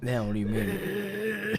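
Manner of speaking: slow whiny fake sob; mock crying. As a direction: pretend to be hurt, slowly. Mock hurt after teasing.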